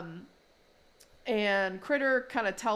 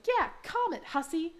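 put-on high-pitched kid taunt; put-on high cheeky child; Louise's taunt; 9 semitones higher than usual. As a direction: pipe up high, egging it on. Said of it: "And Louise is like".

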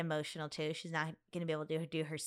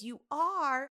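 high, louder amazed question; astonished, pitched-up question; retold surprise; 8 semitones higher, 5 dB louder than usual. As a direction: react to sudden big news. Retold surprised reaction to news.

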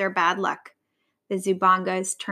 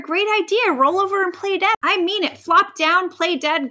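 high-pitched eager command; excited cry; 8 semitones higher than usual. A narrator's reading. Vulture telling lion to play dead, excited cry. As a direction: press the idea on him urgently. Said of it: "cried Vera".

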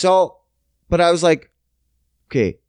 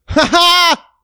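high, loud forced laugh; fake laugh; 7 dB louder than usual. Caught blank by the guest, covering with a high fake laugh. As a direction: bluff with a burst of laughter. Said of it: "And I'm like".

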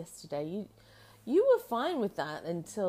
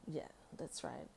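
usual speaking voice

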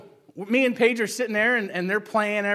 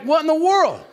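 baffled; 8 semitones higher, 7 dB louder than usual. Retold or imitated. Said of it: "I'm just like".

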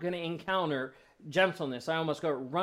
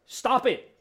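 lightly voiced Bob Newhart, blunt and louder; a hint of the deadpan counselor; curt therapist; 6 semitones higher, 6 dB louder than usual. Counselor Newhart snapping at patients, blunt mock order. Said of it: "he just says to them".

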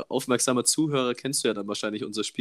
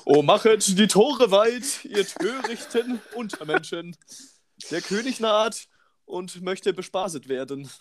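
put-on high, slow, booming old-time king; archaic royal proclamation; 9 semitones higher, slower than usual. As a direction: proclaim it like a pompous medieval herald. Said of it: "so ganz komischen siebzehntes Jahrhundert-Liedern".

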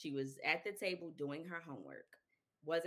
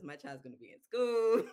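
mock-confident declaration; emphatic certainty; 3 semitones higher, 10 dB louder than usual. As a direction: announce it like it's obvious. Her old certain expectation.

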